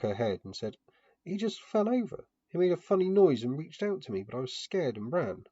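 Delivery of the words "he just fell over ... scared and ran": put-on much higher, louder little girl's voice, frightened; a scared child blurting out what she saw; terrified child. A narrator's reading. Dakarai tells the narrator how her father fell, high and frightened. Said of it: "She was terrified".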